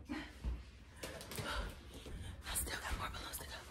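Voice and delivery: soft unvoiced whisper; a tired hushed aside. Real, not acted. Vlogger whispering to viewers about balloons, hushed.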